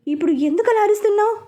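quick, high-pitched alarmed question; narrated fright, pitch jumping up; startled, afraid. A narrator's reading. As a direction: rush it out as if given a fright. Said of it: "అన్నాను భయంగా".

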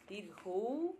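Dirgo-u said carefully, Bengali style; Bengali letter name. Shown as a demonstration. Naming the long u for viewers, careful Bengali sounds. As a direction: give Dirgo-u like a sound to repeat. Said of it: "U larga".

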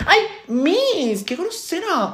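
high, drawn-out indignant complaint; offended whine. Acted. Student refused a hint by the teacher, offended whine.

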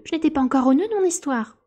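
high, loud, exasperated protest; furious. A narrator's reading. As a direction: protest angrily at being misunderstood. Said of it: "d'un ton furieux". (protestation furieuse, voix haute et forte)

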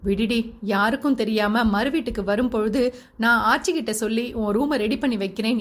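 ordinary delivery

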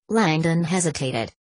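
plain reading voice

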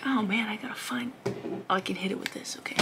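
hushed, breathy whisper while stalking; sneaky whisper. Retold or imitated. Creeping up on a moth, whispering to herself. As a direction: whisper as if not to scare the moth off.

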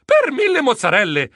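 plain reading voice

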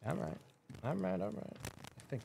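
crackly usb mic. Real, not acted. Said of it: "The mic is crackling and stuff".

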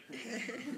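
dragon laugh. Acted. Dragon laughs after threat.

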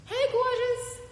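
high, loud delighted hello; gushing greeting. Real, not acted. Host spots a viewer joining the live, gushing. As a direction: sing out an excited greeting.

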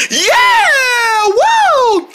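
long, very high whoops; jubilant cheering. Pretend. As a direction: whoop with the whole team. Group celebrates success.

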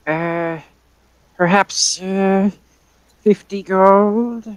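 put-on high, hesitant old lady; old woman slowly naming a price, drawn out at the end; old lady shopkeeper. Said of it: "burp in the old lady voice".

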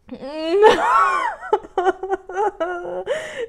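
loud-pitched, long cry-laugh; overwhelmed high cackling sob; high wailing laugh. Real, not acted. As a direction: shriek with laughter close to tears. Overwhelmed at the last card.

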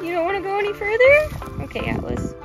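ordinary talk

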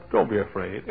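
soft, low-key and soothing; calm reassurance. Shown as a demonstration. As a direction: quietly settle their nerves. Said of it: "but he said".

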